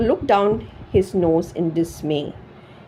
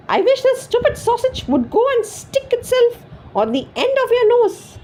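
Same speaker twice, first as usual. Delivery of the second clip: angry shout. A narrator's reading. Wife shouting a spiteful wish at her husband. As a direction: hurl the words at him. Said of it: "she shouted angrily".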